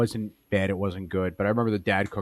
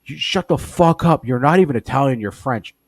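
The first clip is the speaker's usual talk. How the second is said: angry dad; 5 semitones higher, 7 dB louder than usual. Retold or imitated. Retelling how her Italian dad yelled at the mom. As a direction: bark it like a cranky father. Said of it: "he's like".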